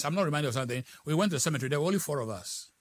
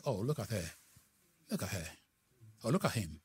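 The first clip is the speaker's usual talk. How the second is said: gossiping whisper; 6 semitones lower, 8 dB quieter than usual. Retold or imitated. Mocking gossips in a sermon.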